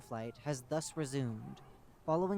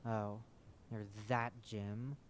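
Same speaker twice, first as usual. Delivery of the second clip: distaste; 4 semitones lower, 4 dB quieter, slower than usual. Acted. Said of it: "with more than a hint of distaste".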